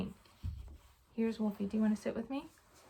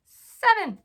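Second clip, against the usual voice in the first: high, loud cheer of the number; excited count; 11 semitones higher, 11 dB louder than usual. Real, not acted. Mom cheering the child for counting seven. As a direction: celebrate the number like a win.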